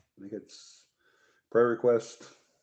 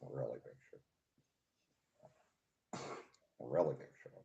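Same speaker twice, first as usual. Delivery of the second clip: softer mock TV host murmur; Ed Sullivan; 5 semitones lower, 9 dB quieter, faster than usual. Mimicking the host beside co-host, softer mock impression. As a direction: repeat the catchphrase low and under the breath. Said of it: "He was always like that".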